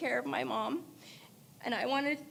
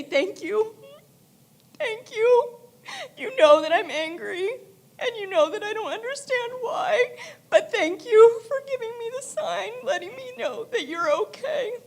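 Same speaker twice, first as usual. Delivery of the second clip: higher and louder, breaking into sobs; crying thanks; 9 semitones higher, 6 dB louder than usual. Real, not acted. Daughter speaks to her late dad at his funeral, crying. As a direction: thank him through tears, half laughing, half crying.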